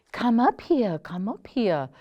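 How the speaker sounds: put-on a light German accent, said softly. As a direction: gently coax in a hint of German accent. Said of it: "Martha said softly in her German accent".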